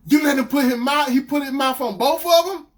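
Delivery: loud, high incredulous rant; outraged disbelief. Retold or imitated. Boyfriend confronting her confession, outraged. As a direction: repeat it back, appalled.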